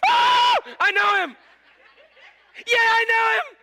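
loud, high, laughing exclamation; overjoyed believer; laughing joy. Acted. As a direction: laugh it out with delight. Acted joyful believer.

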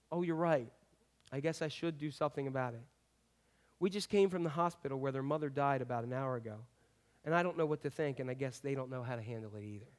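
lightly voiced grieving father, quiet; soft and subdued. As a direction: say it softly, numbed by the loss. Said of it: "and said softly".

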